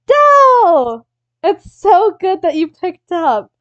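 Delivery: loud, high, gushing hello; excited phone greeting. Retold or imitated. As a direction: burst out with forced delight down the phone. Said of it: "you hear someone on the phone go".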